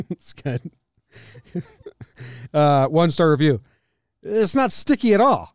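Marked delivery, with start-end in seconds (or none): 4.2-5.5 mock complaining. Reading review in mock voice.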